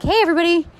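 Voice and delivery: high, bright-voiced cheery hello; mock peppy captain announcement; peppy pilot greeting. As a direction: imitate a chipper captain on the intercom. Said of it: "peppy about it".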